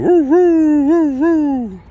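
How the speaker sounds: high, loud howling woo; mock dog howl. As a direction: howl it out like a hound.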